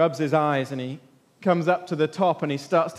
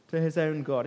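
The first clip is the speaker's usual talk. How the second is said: ordinary talk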